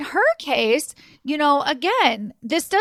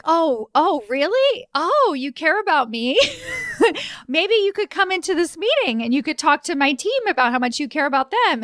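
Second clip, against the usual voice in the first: higher, laughing mock surprise; sarcastic mirroring; 6 semitones higher than usual. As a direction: throw his words back at him with a sarcastic laugh. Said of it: "Then I'm like".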